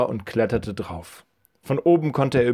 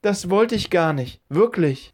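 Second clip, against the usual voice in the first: put-on higher, boyish apology; apologetic boy; 9 semitones higher than usual. Boy caught by garden owner apologises in a higher voice. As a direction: plead innocence in a raised boy's voice.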